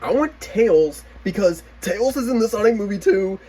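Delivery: put-on high, pleading kid voice; high childish whine; whiny want. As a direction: beg like a greedy kid. Retold childish wanting.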